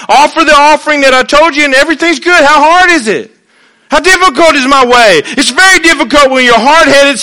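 high, loud impassioned preaching; preaching at full force. Acted. Preacher voicing God to stubborn people, loud and impassioned. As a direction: pour it out as God pleading with rebels.

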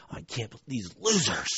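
a soft, under-the-breath grumble; fuming mutter. Retold or imitated. Driver fuming quietly while his wife sleeps, mutter. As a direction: grumble it to yourself, seething. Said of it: "and I'm fuming".

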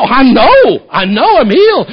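high, loud and certain; joyful insistence. Retold or imitated. Bullfighter insists to the preacher he is healed, loud and high. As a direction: shout it with total certainty.

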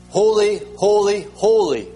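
a loud, high proclaiming holy; seraph's call. Retold or imitated. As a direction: call out like an angel in worship. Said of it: "One says".

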